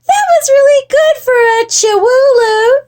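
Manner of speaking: put-on high, loud girlish voice; little sister. A narrator's reading. Jujubee praising her brother's flip, high child voice. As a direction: pipe it up like a small child. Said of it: "said Jujubee".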